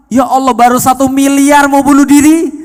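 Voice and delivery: preacher aghast at a woman despairing over one billion; incredulous exclamation. Retold or imitated. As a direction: exclaim in shocked disbelief at how small the debt is. Preacher reacts to debt of one billion.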